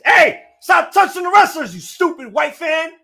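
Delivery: real outrage, shouted high; yelling at a fan. Real, not acted. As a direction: bark at the fan through the TV.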